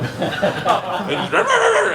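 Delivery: high rolling dog growl; drawn-out mock growling; imitated dog noise. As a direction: mimic a scrappy dog's snarl.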